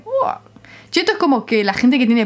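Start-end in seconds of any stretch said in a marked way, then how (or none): 0.0-0.4 a light imitated gasp of wonder; hint of an impressed friend's uah; mock amazed gasp. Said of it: "te viene un uruguayo y dice".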